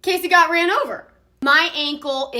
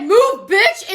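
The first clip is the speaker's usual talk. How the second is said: retold yell; 5 semitones higher, 4 dB louder than usual. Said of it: "looked at me and said".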